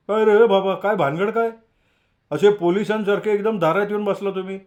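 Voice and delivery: playful fake alarm at the door; mock surprised Jagu. A narrator's reading. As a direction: friend joking about visitors arriving unannounced. Jagu teasing surprised friends.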